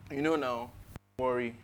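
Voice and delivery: lightly voiced well-meaning consoler, low and soft; mock comforter. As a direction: imitate someone offering easy comfort, hushed and low. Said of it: "people come to me and they tell you".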